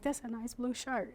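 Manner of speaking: quiet, easy first take; quiet plain remark; soft first try. Shown as a demonstration. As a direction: say it softly once. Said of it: "I'm like".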